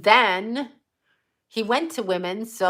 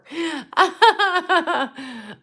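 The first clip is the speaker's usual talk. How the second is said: high, open laugh; real delighted laughter; hearty laugh; 8 semitones higher, 3 dB louder than usual. Real, not acted. Laughs about the actor.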